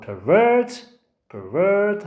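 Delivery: "pervert" stressed on VERT; light PER, long strong VERT in "pervert"; second-syllable stress. Shown as a demonstration. Teacher drills the verb for students, stressing VERT in "pervert". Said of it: "the stress on the second syllable".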